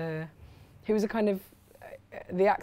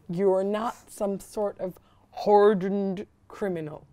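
put-on Philadelphia accent; 7 dB louder, slower than usual. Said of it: "You got it".

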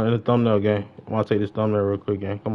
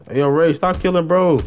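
high, loud call to stop; yelling; 7 semitones higher, 7 dB louder than usual. Real, not acted. Telling teammate Ray to stop killing, loud high yell. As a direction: yell across the game to stop him.

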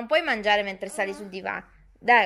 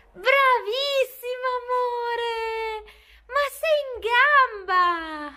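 very high, drawn-out cooing cheer; gushing praise; 13 semitones higher, 3 dB louder, much slower than usual. Real, not acted. Mother praising her child's feat, high-pitched gushing.

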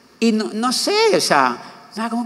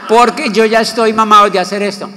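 louder, higher, through laughter; laughing exasperation; 5 semitones higher, 7 dB louder than usual. Real, not acted. To his audience about being tired of this, loud and laughing. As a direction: laugh it out, fed up.

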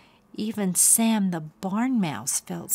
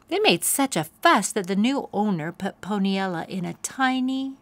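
ordinary delivery

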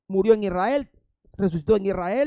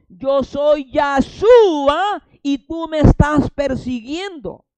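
slow, loud, booming divine voice; voice of the Lord; 6 semitones higher, 7 dB louder, much slower than usual. Acted. The Lord answering Saul on the road, slow loud booming voice. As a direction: boom it down from heaven, very slow. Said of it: "Y el Adón me respondió".

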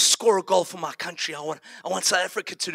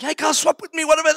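anxious plea; 8 semitones higher, 3 dB louder, faster than usual. Retold or imitated. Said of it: "I'm fretting and I'm anxious".

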